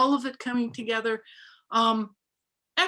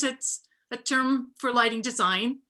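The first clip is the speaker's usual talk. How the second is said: normal talking voice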